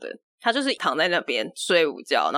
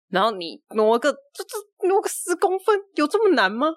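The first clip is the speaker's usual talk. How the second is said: exasperated; 9 semitones higher than usual. Retold or imitated. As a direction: pitch shoots up on 十公分 in disbelief. Venting exasperation at father.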